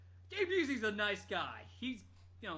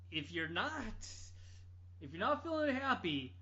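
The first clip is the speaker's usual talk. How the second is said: ordinary talk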